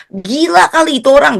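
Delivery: high scoffing outburst; grumbling dad. Retold or imitated. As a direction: imitate an exasperated father. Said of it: "Papa saya ngomel-ngomel".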